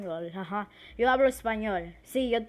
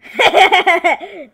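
high loud laugh; loud burst of laughter; 8 semitones higher, 17 dB louder than usual. Real, not acted. Gamer cracking up at her own slip, burst of laughter.

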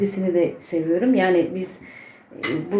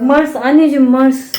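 calling out; 6 semitones higher, 9 dB louder than usual. Real, not acted. Calling the cat.

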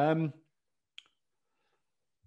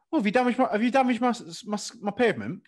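mock dinner lady; 6 semitones higher than usual. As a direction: scold the child about the pavement, not the knee. Dinner lady scolding hurt kid.

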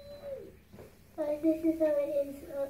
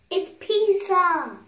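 excited; 5 semitones higher, 9 dB louder, slower than usual. Real, not acted. Excited discovery in bath.

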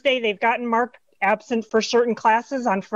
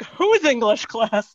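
slow, drawn-out puzzled question; mock bewilderment; much slower than usual. Confused parent reacting to an email, mock bewilderment. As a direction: stretch it out as if totally lost.

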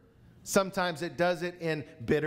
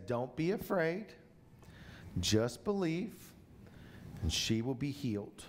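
softly spoken; 6 semitones lower, 6 dB quieter than usual. Shown as a demonstration. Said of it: "he says".

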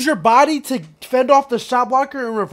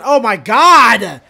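disbelief; 7 dB louder, much slower than usual. Real, not acted. Reacting to bad play.

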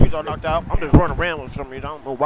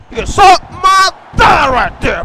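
high, loud, drawn-out taunt; shouted taunt; 13 semitones higher, 8 dB louder, slower than usual. Pretend. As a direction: yell a slow insult at your opponent. Taunting his opponent in play.

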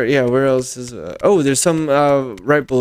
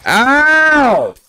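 yelp of pain; 12 semitones higher, 6 dB louder than usual. Real, not acted. Hurt in game.

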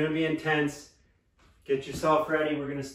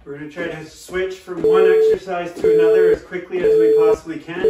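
loud coaching; 7 dB louder than usual. Real, not acted. Coach cueing over workout music.

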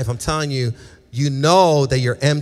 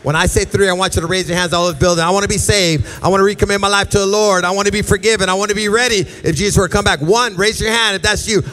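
loud, high, fast prayer lead; full-force preaching; 5 semitones higher, 6 dB louder than usual. Acted. Pastor leading the room in a prayer to be saved, loud and urgent. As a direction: drive the room to raise their hands.

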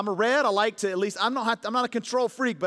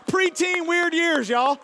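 mock dramatic; 9 semitones higher, 6 dB louder, much slower than usual. Pastor jokes about turning eleven.

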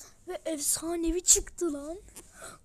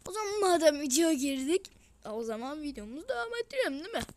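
usual speaking voice

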